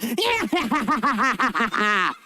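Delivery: wicked witch laugh on a broom; evil witch cackle. Acted. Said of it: "Let me hear it one more time".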